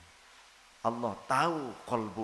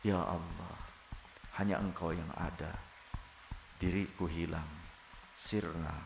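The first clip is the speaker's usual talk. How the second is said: lower, softer, pleading prayer; soft intimate prayer; 6 semitones lower, 5 dB quieter than usual. Shown as a demonstration. The soul's other part praying to God, soft intimate prayer. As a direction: pray inwardly as if alone with God. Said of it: "Bagian yang lainnya berdoa".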